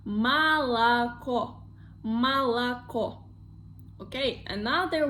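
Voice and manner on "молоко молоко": молоко stretched out as ma-la-KO; slow model pronunciation. Shown as a demonstration. Teacher repeating for learners, молоко slowly as ma-la-KO. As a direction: say молоко slowly with "ah" in the first two syllables. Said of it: "previous ones we pronounce like A".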